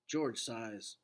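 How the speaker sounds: plain reading voice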